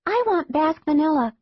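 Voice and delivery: put-on a little girl showing off; bratty little girl. A narrator's reading. Girl teasing her brother, high and smug. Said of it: "the girl said".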